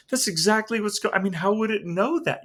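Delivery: astonished man who can't believe the cards knew; stunned disbelief. Retold or imitated. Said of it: "he was just stunned".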